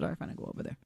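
whispered under the breath, grudgingly giving in; grudging mutter. Retold or imitated. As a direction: mutter it as you give up the argument. Grudging reply to poll worker.

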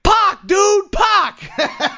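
high, loud shouted answer from afar; friend yelling back. Retold or imitated. Friend shouts the answer back from a distance, loud yell. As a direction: holler the name back up the street.

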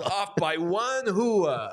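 "hua" drawn out as HOO-ah; Sopranos pronunciation. Acted. Joking about offside like The Sopranos, "hua" said HOO-ah. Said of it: "I've been watching The Sopranos a lot".

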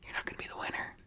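hushed secret aside; stage whisper. Pretend. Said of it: "This is a stage whisper".

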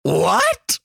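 very high startled squeal; shocked what. Retold or imitated. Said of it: "I just said".

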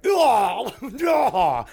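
loud laughing "Oh, no" of fake horror; mock shock. Host parodying people shocked by age gaps, mock shock. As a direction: gasp it out like a scandalized prude.

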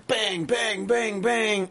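punchy mock gun noises; imitated gunshots. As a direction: mimic rapid gunfire with the word. Imitating the gun being fired.

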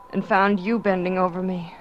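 ordinary delivery

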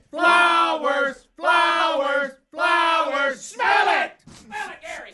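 excited shouting. Real, not acted. Host hyping the wheel result.

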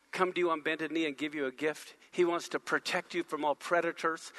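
soft intimate preaching. Acted.

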